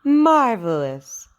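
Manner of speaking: slow, big, delighted cheer; excited praise. Real, not acted. Praising a child after practice.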